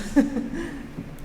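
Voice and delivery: breathy, airless nervous giggle; nervous laugh. Real, not acted. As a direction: laugh off your nerves. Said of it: "Sorry, I'm a little nervous".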